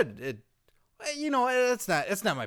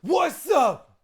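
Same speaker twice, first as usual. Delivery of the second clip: loud, high hollered entrance; big whooping greeting; shouted greeting; 10 semitones higher, 7 dB louder than usual. Acted. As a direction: burst in hollering hello. Acted entrance of R-Truth, calling out.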